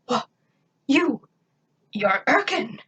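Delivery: stunned, halting gasp; shocked alien stammer; disbelief. A narrator's reading. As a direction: stumble over it in shock. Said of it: "he said in disbelief".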